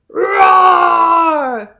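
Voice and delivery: loud high roar; lion roar. A narrator's reading. Lion roaring in the net, loud. Following lion's roars.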